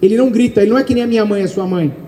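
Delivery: normal talking voice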